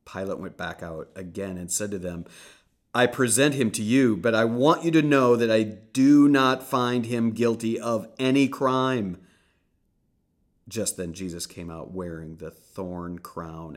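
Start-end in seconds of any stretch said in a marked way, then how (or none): 2.9-9.2 raised voice, higher, projecting to a crowd; loud proclamation. Acted. Pilate announcing to the crowd, raised voice. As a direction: call it out over the mob.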